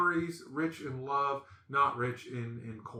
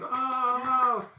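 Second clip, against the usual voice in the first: mock dismay; 10 semitones higher, 4 dB louder than usual. Family sees repeated gift, dreading.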